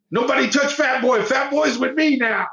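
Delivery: high, protective boast; tough inmate. Retold or imitated. Inmate protecting the famous kid, high swaggering claim. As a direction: claim him as your own, swaggering.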